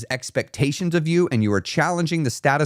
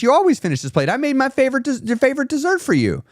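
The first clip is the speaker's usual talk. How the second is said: mock pushy friend, high and coaxing; pushy friend imitation; 10 semitones higher than usual. Mocking friends who push old habits, high coaxing voice. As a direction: mimic a nagging friend in a lifted voice. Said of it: "people will continually try to remind you".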